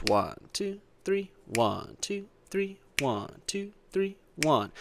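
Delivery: steady count, accent falls on one; counting in three. Shown as a demonstration. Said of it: "It'll sound like this".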